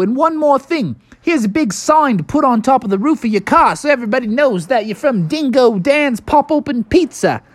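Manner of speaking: high-pitched, brisk Aussie dingo boss; Australian accent. Acted. Dingo Dan hands Bubby his orders, high Australian accent. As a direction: send him off in a high Australian voice. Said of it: "he talked in an Australian accent".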